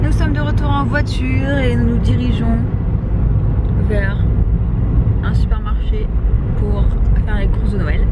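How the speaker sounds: talking around a throat lozenge; lozenge in mouth. Real, not acted. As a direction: lozenge in mouth, words a bit muffled. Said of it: "une pastille pour la gorge dans la bouche".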